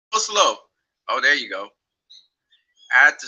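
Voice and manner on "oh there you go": ordinary delivery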